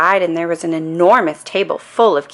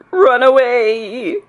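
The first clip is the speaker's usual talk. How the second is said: scared slow wail; 7 semitones higher, 3 dB louder, much slower than usual. A narrator's reading. Cop frightened.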